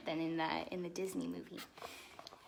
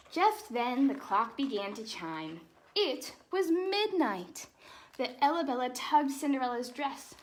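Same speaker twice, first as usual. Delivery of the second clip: lively storybook read-aloud; animated picture-book narration; reading to children; 4 semitones higher, 7 dB louder, slower than usual. Acted. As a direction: read it like bedtime story time for kids.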